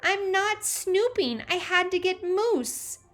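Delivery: high, loud, slow protest; little sister insisting loudly; indignant child voice. A narrator's reading. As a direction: defend yourself in a little-girl whine. Teresa defends herself.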